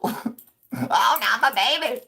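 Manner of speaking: very high, loud wailing plea, slow on the last part; funeral mourner wail. Retold or imitated. Imagining himself at the funeral as a wailing mourner. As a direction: wail like a grieving church lady at a funeral.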